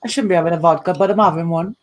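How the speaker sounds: ordinary talk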